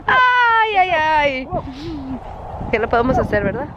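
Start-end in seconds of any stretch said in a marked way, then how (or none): 0.1-0.8 high, loud laugh near tears; crying laughter. Real, not acted. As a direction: laugh till it hurts. Se ríe hasta llorar. (risa aguda casi llorando)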